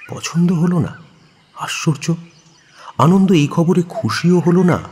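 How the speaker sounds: ordinary talk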